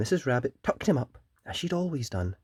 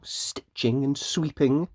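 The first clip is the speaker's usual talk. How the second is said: ordinary delivery, nothing marked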